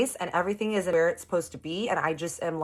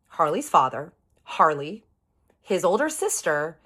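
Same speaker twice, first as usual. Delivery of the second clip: ordinary talk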